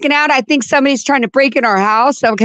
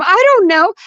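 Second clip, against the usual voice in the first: dazed fear; 9 semitones higher than usual. Retold or imitated. Drugged wife answering husband.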